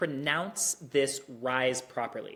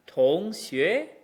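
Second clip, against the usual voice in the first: 同学 with the second syllable pitched a little higher; higher tone variant; 3 semitones higher, 4 dB louder than usual. Shown as a demonstration. Teacher showing an acceptable variant, second syllable higher. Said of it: "I went a little higher on the second one".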